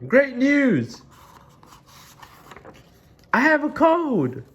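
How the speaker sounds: high, thrilled exclaiming; gleeful announcement. Acted. Gerald announces good news to Piggy.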